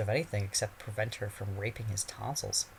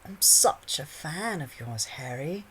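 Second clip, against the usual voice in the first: put-on slow, dreamy, high girlish; 6 semitones higher, 4 dB louder, slower than usual. A narrator's reading. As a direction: breathe it out dreamily. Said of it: "she breathed dreamily".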